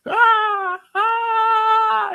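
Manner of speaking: high movie scream; imitated scream. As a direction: shriek like the Psycho shower scene. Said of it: "that Janet Leigh scream".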